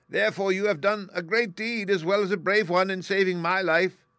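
high, loud, tiny regal voice; squeaky mouse queen. A narrator's reading. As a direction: thank him like a grand little queen. Mouse queen thanks Woodman.

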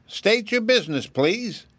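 high, curt interruption; curt rabbit. A narrator's reading. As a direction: cut her off, brisk and official. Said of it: "interrupted the rabbit".

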